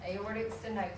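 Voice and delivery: ordinary talk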